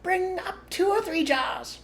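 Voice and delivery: very high, slow distant call; calling back. A narrator's reading. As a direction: call down from the top. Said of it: "the other voice called back".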